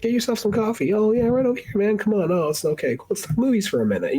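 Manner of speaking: high, friendly put-on voice; mock schmoozy producer. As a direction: oversell the charm like a fake-nice boss.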